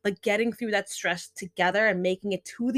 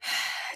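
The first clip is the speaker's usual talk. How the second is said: long unvoiced exhale, slow and audible; deep breath out; 6 dB quieter than usual. Shown as a demonstration. Teacher leading the class in a calming breath, slow audible exhale. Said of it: "Take a deep breath with me".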